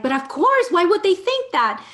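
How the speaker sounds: loud, high mock parent protest; loud disbelief. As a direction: play the parents' stunned objection. Parents' shocked reaction.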